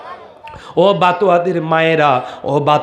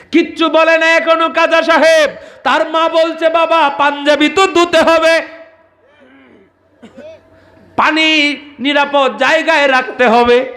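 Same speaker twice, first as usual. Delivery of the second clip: preaching at full force; 8 semitones higher than usual. Acted. Preacher telling a saint's story to the crowd, at full force. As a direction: call it out to the crowd like a village waz.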